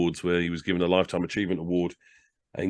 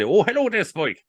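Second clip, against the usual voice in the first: higher, louder Irish lilt on the phone; jovial Irish greeting, higher and louder; Irish consulate voice; 12 semitones higher, 5 dB louder than usual. Retold or imitated. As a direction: answer like a cheery Irish official, pitched up. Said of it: "As only the Irish could do it".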